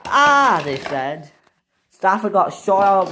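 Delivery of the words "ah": loud high "ah!"; story scream of alarm; acted scream. Engines react to roof caving in.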